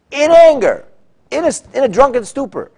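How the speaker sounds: high, loud burst of emphasis; loud exclamation. Real, not acted. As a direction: cry it out like an outraged preacher. Teacher dramatizes king's rage.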